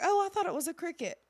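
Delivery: high, loud, unbothered dad; dad shrugging off the snake; casual dad. Retold or imitated. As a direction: toss it off like it's nothing. Dad's casual reply retold.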